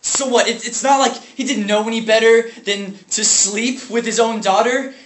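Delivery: high, loud, incredulous outburst; outraged. Acted. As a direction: throw it back furiously as a challenge. Outraged about father's abuse of sister.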